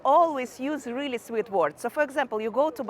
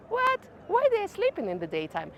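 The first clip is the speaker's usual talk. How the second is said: baffled foreigner; 7 semitones higher, 4 dB quieter than usual. Retold or imitated. Foreigner's baffled reaction quoted.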